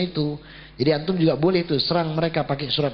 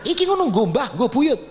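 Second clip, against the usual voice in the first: put-on higher, slightly louder old-man voice; father-in-law voice; 8 semitones higher, 3 dB louder than usual. Father-in-law explaining offerings to his son-in-law, higher imitated voice.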